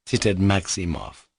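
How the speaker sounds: ordinary delivery, nothing marked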